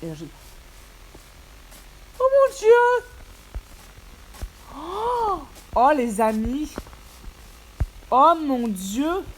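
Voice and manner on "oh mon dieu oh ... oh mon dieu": high squealing delight; overjoyed exclamation. Real, not acted. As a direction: burst out in joy. Finds village, overjoyed.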